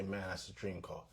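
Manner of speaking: a soft awed murmur at a dream car; hushed admiring. Retold or imitated. Admiring a stranger's car, murmured softly. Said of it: "look at and say".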